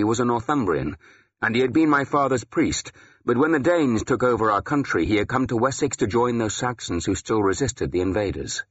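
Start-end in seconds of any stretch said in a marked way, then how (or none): none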